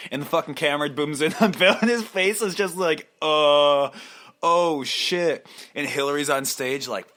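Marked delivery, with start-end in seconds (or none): 3.2-5.5 loud, startled mock groan; mock dismay. Bill caught on camera.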